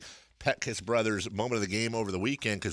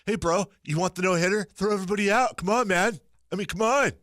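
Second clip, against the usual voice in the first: loud, higher bro-speak dude voice; millennial voice; 9 semitones higher, 4 dB louder than usual. Pretend. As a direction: play a pushy young bro. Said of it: "That's my millennial voice".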